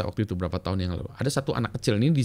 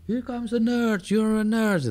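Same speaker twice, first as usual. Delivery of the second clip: high, slow sing-song taunt; mocking schoolmates; 12 semitones higher, 4 dB louder, much slower than usual. Kids greeting the computer boy, mocking taunt. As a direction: tease him in a much higher voice.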